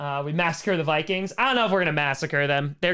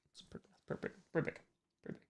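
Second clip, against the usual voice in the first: quiet tease; 2 semitones higher, 18 dB quieter, much faster than usual. Pretend. Teasing size of ship.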